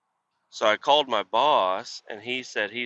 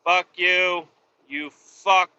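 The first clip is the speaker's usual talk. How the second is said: louder, higher angry curse; angry cursing; 5 semitones higher, 5 dB louder, much slower than usual. Real, not acted. Trucker cursing the driver who needed that exit, angry and louder. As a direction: hurl it at him as he exits.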